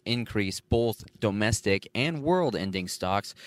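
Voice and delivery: usual speaking voice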